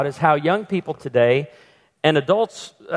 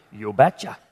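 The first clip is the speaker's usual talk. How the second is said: lower, thick Norwegian "You betcha"; Norwegian accent; 6 semitones lower than usual. Retold or imitated. Pastor imitating Norwegian father saying yes in Norwegian accent. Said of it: "in his thick Norwegian accent".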